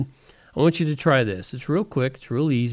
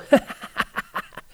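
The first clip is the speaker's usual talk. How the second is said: breathy laugh demo. Shown as a demonstration. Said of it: "then just start laughing".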